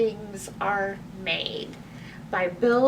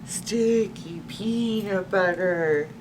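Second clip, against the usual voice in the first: zombie moan; 3 semitones lower, slower than usual. A narrator's reading. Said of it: "moaned Reginald".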